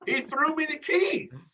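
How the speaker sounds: high, slow, laughing through the words; laughing disbelief. Real, not acted. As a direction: laugh it out, amazed he got a free car. Laughing high incredulous retelling.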